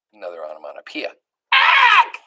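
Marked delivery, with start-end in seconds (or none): 1.5-2.2 high, loud shocked ack; cook's shriek. Acted. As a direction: shriek at the mouse. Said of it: "said the cook".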